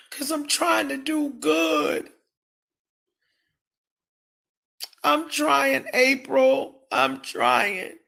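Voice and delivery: pleading while close to crying; on the verge of tears. Real, not acted. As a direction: voice cracking as she fights back tears. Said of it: "You're gonna make me cry".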